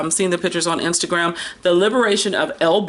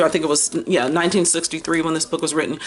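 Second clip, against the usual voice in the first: ordinary delivery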